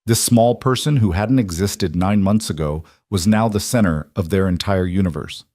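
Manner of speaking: plain reading voice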